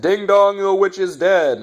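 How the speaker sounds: loud, high sing-song announcer voice; imitating an announcer's pun; mock announcer. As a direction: ham up the cheesy announcer. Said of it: "they said".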